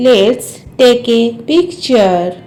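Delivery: measured, word-by-word language model. Shown as a demonstration. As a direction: draw the sentence out for learners. English model repeated slowly for learners.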